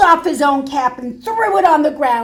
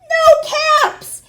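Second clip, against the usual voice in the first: much higher, louder cry of dismay; alarmed cry; 8 dB louder than usual. Acted. Peddler finding no caps, high alarmed cry.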